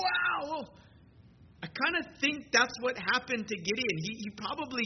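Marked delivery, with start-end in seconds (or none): 0.0-0.7 a high, jumpy startled gasp of a word; startled wow. Retold or imitated. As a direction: jump out of your skin. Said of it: "And you're like".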